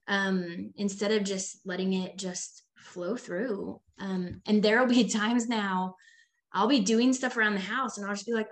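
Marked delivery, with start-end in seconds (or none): none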